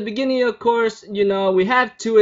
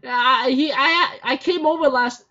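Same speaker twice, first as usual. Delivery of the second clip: much higher panicked protest; flustered denial; 9 semitones higher than usual. Retold or imitated. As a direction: stammer it out in a nervous fluster. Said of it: "Kazu's like".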